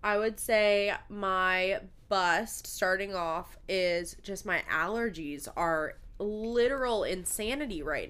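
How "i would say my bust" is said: normal talking voice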